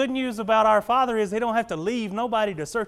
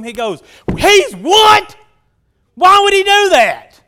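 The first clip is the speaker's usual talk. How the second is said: indignant, high-pitched shouted disbelief; mad; 12 semitones higher, 16 dB louder, slower than usual. Acted. Older brother hearing of his brother's welcome, mad shouting.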